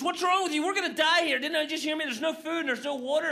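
high, loud panicked plea; panicking. Retold or imitated. Stranded man panicking at his calm companion, high and loud. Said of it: "who's panicking already".

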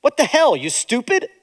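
retold father scolding his child; harsh father. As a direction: snap it out like a belittling dad. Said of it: "He said".

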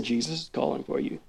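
subdued, resigned report; just pass the message on, deflated; flat and quiet. Shown as a demonstration. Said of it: "She just came and said".

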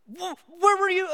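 father clutching his found daughter; frantic relief. Retold or imitated. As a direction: blurt it out shaking with relief. Father finds lost daughter.

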